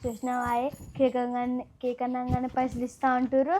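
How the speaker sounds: low, soft side comment; hushed aside. Acted. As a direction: keep it down, just between us. Aside remarking on the others. (పక్కవాళ్ళతో మెల్లగా, తగ్గు స్వరంలో)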